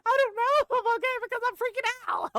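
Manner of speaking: fast, high, loud panicked blurt; high-pitched frantic answer to the bystanders; freaking out. Retold or imitated. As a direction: gush it out in a frantic rush. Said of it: "I'm just like".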